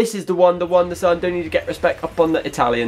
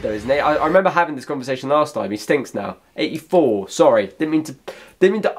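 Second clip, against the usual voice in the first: ordinary delivery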